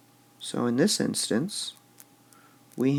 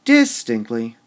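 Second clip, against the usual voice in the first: overdone DIS opening distinctly; stress on first syllable; 8 dB louder than usual. Shown as a demonstration. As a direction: wrongly punch the start of distinctly. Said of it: "Would we say".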